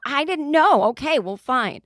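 loud, high, embarrassed snap; huffy outburst. Retold or imitated. Passenger brushed off by the pilot, loud huffy snap. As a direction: bark it back like caught out and annoyed. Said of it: "And she said".

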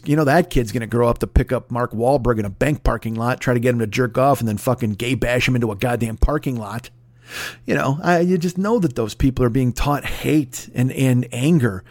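ordinary talk

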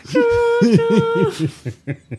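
high, slow hammed-up voice; goofy mock voice. Friend riffing on gravy in a silly high voice. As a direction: clown it up.

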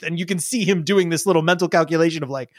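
ordinary talk

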